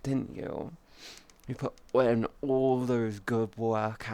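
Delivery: soft, slow cooing praise; praising chat like good pets; soothing praise. Pretend. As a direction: coo gently like to a pet.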